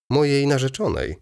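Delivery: surprised anyone would ask. A narrator's reading. Baron answering Wokulski's question, surprised. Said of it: "odparł baron tonem zdziwienia".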